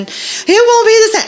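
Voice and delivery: high-pitched mock whine; bawling child. Mom imitating child refusing a replacement toy, tearful whine. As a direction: protest like a heartbroken kid.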